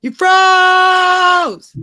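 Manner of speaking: sudden high loud cry of alarm; real startled yell at the screen; startled shout. Real, not acted. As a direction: shout it out as the picture freezes. Stream freezes during setup.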